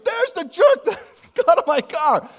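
high, loud, laughing mock accusation; giggling at his own imagined exposure. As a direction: crack up mid-line. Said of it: "I was saying".

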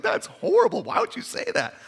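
high mock-appalled outcry; shocked listener. Imagined listener to the preacher, mock-appalled. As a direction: play a scandalised listener. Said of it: "You're like".

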